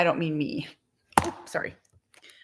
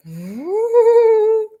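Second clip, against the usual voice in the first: delighted gasp; 12 semitones higher, 8 dB louder than usual. Real, not acted. Mom gasping at a dining room turned laundry room. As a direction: gasp then a high, loud ooh.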